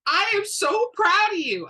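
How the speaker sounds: high, loud, beaming praise; proud joyful cry. A narrator's reading. Great-grandmother to her grandson's news, high proud joy. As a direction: let the pride burst out on proud.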